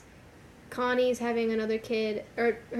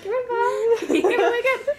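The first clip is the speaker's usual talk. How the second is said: put-on very high, loud child voice; imitated little kid; 13 semitones higher, 7 dB louder than usual. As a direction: squeal like a kid, half laughing. Acting kid watering grandfather.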